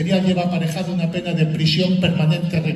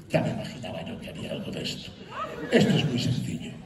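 low, soft professional murmur; mock calm therapist; 6 semitones lower, 7 dB quieter than usual. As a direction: imitate an unruffled psychologist, voice dropped. Therapist calmly delivers his verdict.

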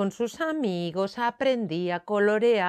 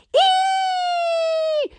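very high, loud held mock scream; shrieked squeal; 6 dB louder than usual. A narrator's reading. Storyteller as Moncho refusing home, screaming. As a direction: shriek on one long high note. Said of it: "y chillaba".

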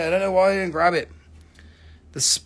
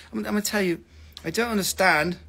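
ordinary talk

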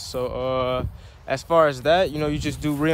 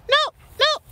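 high-pitched no; 6 dB louder than usual. Shown as a demonstration. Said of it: "say it in a high-pitched voice".

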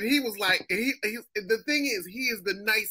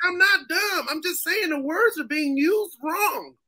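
mock protest; 8 semitones higher, slower than usual. Acting himself protesting the ridicule.

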